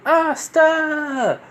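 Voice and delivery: high, long drawn-out shout of a name; acted high-pitched cry; calling out. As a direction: holler it as an excited call from afar. Retold call from an opening portal.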